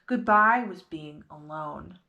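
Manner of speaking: ordinary delivery, nothing marked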